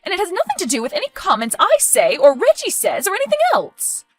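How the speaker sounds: high, loud motherly scolding; heated, overwrought confrontation; angry accusation. Acted. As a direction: lay down the law, loud and high. Mother confronts daughter over supposed addiction.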